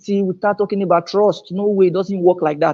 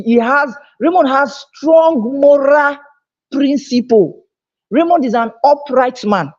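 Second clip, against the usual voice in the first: raised, rising preacher's cadence, pitch higher; preaching; 5 semitones higher than usual. Acted. Seminar speaker preaching integrity to the audience, higher and fervent. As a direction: drive the point home like a pulpit sermon.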